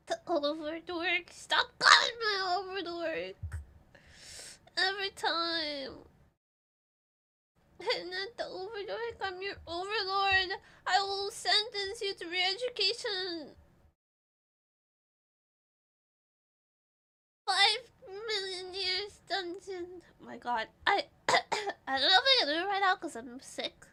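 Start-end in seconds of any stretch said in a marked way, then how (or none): 1.3-3.4 higher, louder whine; mock-offended whining; whiny protest. Protesting the chat nickname. 7.8-20.0 higher, hammed-up tyrant decree; playful fake-villain proclamation; mock overlord. Playing the overlord to chat.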